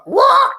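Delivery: very high, loud squawk; imitated bird squawk. Comic making the singer laugh mid-take, high loud squawk. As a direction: let out a sudden bird screech. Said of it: "started doing little sounds like".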